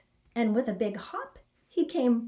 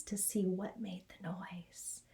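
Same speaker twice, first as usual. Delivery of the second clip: whispering; 4 semitones lower, 11 dB quieter than usual. A narrator's reading. Narrator whispers tiptoeing.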